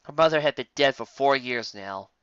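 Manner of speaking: ordinary delivery, nothing marked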